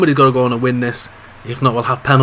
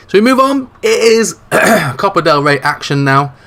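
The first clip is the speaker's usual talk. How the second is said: bright restart; 6 semitones higher, 5 dB louder than usual. Acted.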